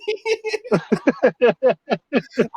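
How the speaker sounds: long, high, helpless laugh; real laughter. Real, not acted. Laughing at his own gun-photo story, long high laugh. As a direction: burst out laughing at yourself.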